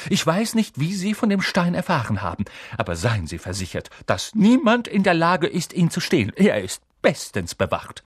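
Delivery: high, emphatic assurance; authoritative teacher's rebuke; stern professor. A narrator's reading. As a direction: firmly shut down the pupil.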